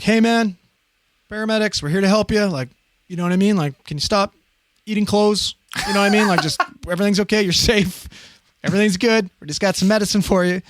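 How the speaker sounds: calm him down gently, voice lifted; reassuring. Retold or imitated. Soothing agitated patient.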